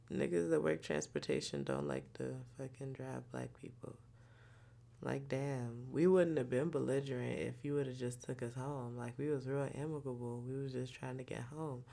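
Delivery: quiet grumble. Real, not acted. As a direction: mutter the grievance softly.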